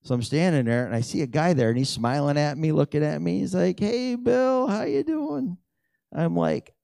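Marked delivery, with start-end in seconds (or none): 3.8-5.6 high, bright-voiced hello from an old friend; cheery greeting. Old friend spotting him after the game, calling out high and cheery. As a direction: call out like you're glad to see him.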